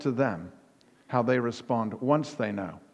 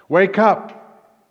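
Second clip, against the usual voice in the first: loud, high wake-up call; preacher voicing Peter's loud, high call to wake up; rousing exhortation; 4 semitones higher, 5 dB louder than usual. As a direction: shout them awake, then ease off. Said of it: "here's a paraphrase of what he says".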